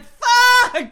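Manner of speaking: sudden loud high curse. Real, not acted. Cursing loudly at the machine. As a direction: blurt it out loud. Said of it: "I like how you said that".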